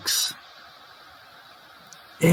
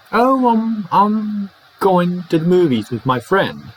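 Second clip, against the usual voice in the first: higher-pitched example answer; acted dialogue reply; 7 semitones higher than usual. Friend answers question about tonight, higher acted reply. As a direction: answer as the other person, pitched up.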